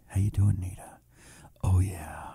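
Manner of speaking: low, soft seductive murmur; mock sexy. Host flirts jokingly with viewer who loves his voice, mock sexy. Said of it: "I try to sound all sexy".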